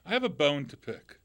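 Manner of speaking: ordinary talk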